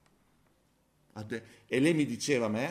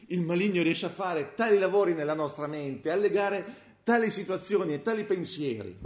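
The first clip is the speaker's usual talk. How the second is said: louder, higher urgent sermon voice; impassioned preaching; 7 semitones higher, 6 dB louder than usual. Acted. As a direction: warn the congregation with force. Preaching peak. (predica infervorata e forte)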